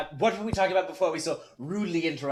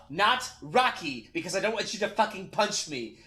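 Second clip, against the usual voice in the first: usual speaking voice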